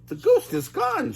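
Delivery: high cartoon Shaggy; high relieved Shaggy. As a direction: sigh that it's gone. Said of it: "Shaggy said".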